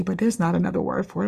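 normal talking voice

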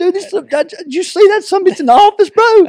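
very high, loud, worked-up voice; retold frantic reply. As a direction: blurt it at a friend, voice way up.

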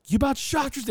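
high-pitched frightened outburst; panicked dad. Retold or imitated. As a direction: blurt it out in fright, voice way up. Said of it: "He's like".

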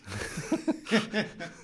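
sudden high-pitched laugh. Real, not acted. Laughs at own remark.

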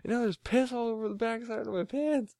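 put-on high-pitched upset kid; high whiny kid voice. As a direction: whine it out high. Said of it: "He's like".

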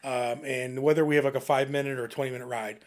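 ordinary talk

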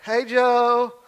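breezy call to Joe, as if nothing's up; cheery greeting. Acted.

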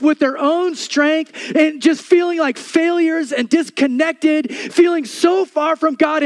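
loud, high, insistent preacher's cry; full-force preaching. Acted. Pastor to congregation, preaching loud and high. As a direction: pound home God's love to the crowd.

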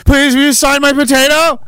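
high, pleading fan voice, lightly played. Fan begging the politician to sign her potato, high and pleading. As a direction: beg sweetly for the autograph. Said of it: "everyone has potatoes that they want him to sign".

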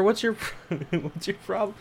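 ordinary delivery

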